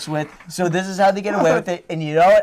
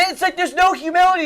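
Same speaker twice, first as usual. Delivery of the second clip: normal talking voice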